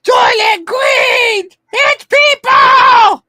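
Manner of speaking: slow, high, shouted reveal; dramatic shout. Pretend. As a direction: scream the movie line in horror. Shouting famous movie quote.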